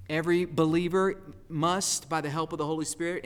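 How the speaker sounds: usual speaking voice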